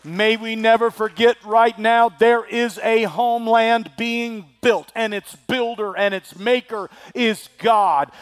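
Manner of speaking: ordinary talk, nothing marked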